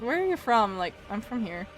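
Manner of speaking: ordinary delivery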